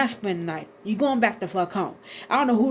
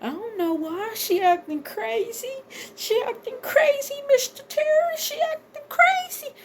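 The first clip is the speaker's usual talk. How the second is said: squeaky, rising fake-crying whine, much higher; mock whiny tattling; 14 semitones higher than usual. Girlfriend whining to Mister Terry, in a mock high crying voice. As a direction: play her as a whimpering tattletale, pitch climbing to a squeal.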